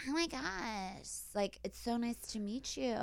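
soft, high, drawn-out effeminate impression; femme voice. Straight guy greeting his date, femme voice. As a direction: imitate a man's femme voice, slow and airy. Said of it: "femme voice is like".

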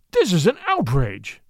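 outburst in another voice; outraged blurt. A narrator's reading. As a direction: blurt out a protest in court. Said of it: "Saul Whitberg blurted out".

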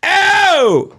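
high loud happy "Oh!"; Al Roker's reply. Retold or imitated. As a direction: answer back with a big high shout. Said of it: "and he'd go".

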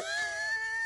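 a real laugh cutting into the story; breathy high laugh. Real, not acted. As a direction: laugh breaking through the quote. Laughing mid-quote.

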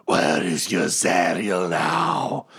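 drawn-out slow monster taunt; hammed-up, stretched-out screech; mock scream. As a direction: scream it slowly, without real volume. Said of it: "screams like".

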